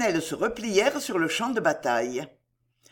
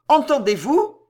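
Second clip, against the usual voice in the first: high, loud urgent question; alarmed cry; 7 semitones higher, 7 dB louder than usual. A narrator's reading. Woman alerting the troop, alarmed cry. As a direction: shout it as a warning. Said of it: "cria une ribaude".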